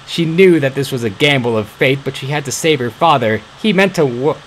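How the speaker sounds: ordinary delivery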